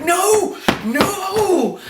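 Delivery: very high, wailing mock protest; mock horrified cry. Viewer watching worms get on the hero, mock horror. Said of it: "and you were like".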